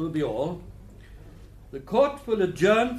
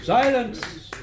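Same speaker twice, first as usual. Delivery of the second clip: sharp loud call for silence, higher; shouted order; 7 semitones higher, 5 dB louder than usual. Acted. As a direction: bark it over a noisy courtroom. Judge calls the courtroom to order.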